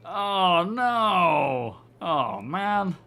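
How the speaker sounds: loud, high oh no; dismayed exclamation. Real, not acted. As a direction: groan out a disappointed oh no. Missed the explosion on comms.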